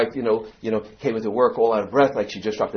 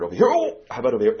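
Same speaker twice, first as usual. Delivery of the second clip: doctor voice; 13 semitones higher, 4 dB louder, faster than usual. Retold or imitated. Doctor probing sore knee.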